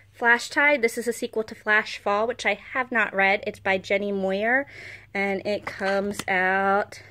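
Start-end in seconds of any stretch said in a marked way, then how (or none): none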